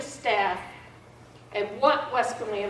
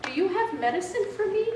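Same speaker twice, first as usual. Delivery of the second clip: put-on higher, childlike request; coaching mom's words; 10 semitones higher than usual. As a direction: voice the line as mom would say it. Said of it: "I want you to say to the nurse".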